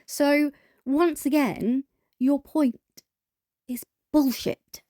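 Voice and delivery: high, drawn-out, word-by-word put-down; slow, pointed verdict. Real, not acted. Dismissing the rival's charity argument, slow pointed verdict.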